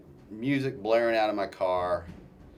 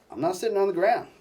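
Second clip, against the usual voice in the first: angry refusal; 11 semitones higher, 4 dB louder, faster than usual. Retold or imitated. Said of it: "and I angrily refused".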